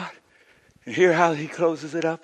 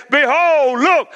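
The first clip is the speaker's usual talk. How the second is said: higher, half-crying shout, slowed; God's proclamation; 7 semitones higher, slower than usual. Acted. Preacher voices God announcing everything new, crying out. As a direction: cry it out from the throne. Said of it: "the one sitting on the throne said".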